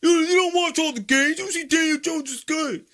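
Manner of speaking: very high, loud whiny fan voice; mocking caricature of protesting supporters. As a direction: squeal it like an indignant, hammed-up fan. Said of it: "Everyone says".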